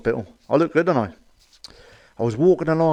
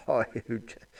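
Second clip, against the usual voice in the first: much softer "Hi, who Jazz?" half laughed under the breath; laughing repeat; 11 dB quieter than usual. Real, not acted. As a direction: mutter "Hi, who Jazz?" through a giggle. Repeats punchline while laughing.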